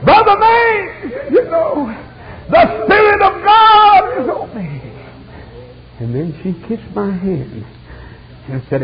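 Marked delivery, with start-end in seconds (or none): 0.0-4.8 high, drawn-out shout of rapture; loud, slow, soaring preacher's cry; ecstatic cry. Retold or imitated. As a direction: holler the testimony at full force. Girl's ecstatic cry retold.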